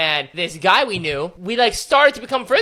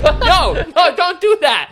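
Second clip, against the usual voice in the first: higher, louder no no go away; whiny loud push-away; mock protest; 8 semitones higher, 6 dB louder, slower than usual. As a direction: shoo him off in mock despair. Retold protest to visitor.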